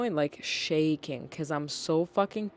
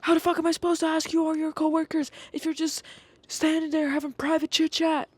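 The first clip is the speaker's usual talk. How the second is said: high, loud mock old-man outburst; yelling grandpa; 11 semitones higher, 4 dB louder than usual. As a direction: imitate an irate grandpa, pitched way up. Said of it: "he keeps yelling at me".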